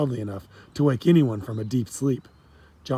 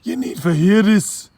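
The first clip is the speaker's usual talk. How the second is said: loud, much higher Jamaican shout across the dock; yelling; 8 semitones higher, 8 dB louder, slower than usual. Acted. Dockworker yells to Sean, loud Jamaican call. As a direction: call out to a friend, full voice. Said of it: "He turned and yelled".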